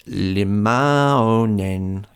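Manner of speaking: slow, stiff, syllable by syllable; sounding out a name like a robot. Real, not acted. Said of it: "I sounded like a robot".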